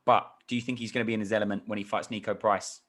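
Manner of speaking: ordinary delivery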